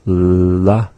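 la with the tongue tip up; plain L sound. Shown as a demonstration. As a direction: say la with a clear L. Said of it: "begin with the L".

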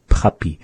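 "puppy" with a heavy puff of breath on the first P; exaggerated P. Shown as a demonstration. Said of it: "really exaggerate those".

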